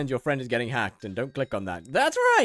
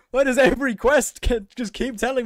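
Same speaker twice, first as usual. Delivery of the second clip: laughing rant; 8 semitones higher, 6 dB louder than usual. Real, not acted.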